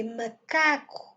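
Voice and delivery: macaco with a long middle CA; stress on CA. Shown as a demonstration. Teacher repeats correct macaco, stress on middle CA. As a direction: lean on CA in macaco, weak ending. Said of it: "On donne l'accent sur C A".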